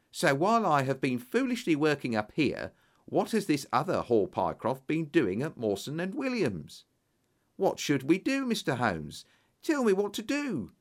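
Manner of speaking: agitated clerk, pitch climbing to a high plea; distraught Hall Pycroft. Acted. Voices distraught Hall Pycroft in dialogue.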